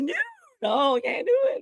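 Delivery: high, yelping mock despair; hurt-puppy whine. Performer failing his Howard Finkel impression, puppy-like whine. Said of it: "like a puppy got stepped on".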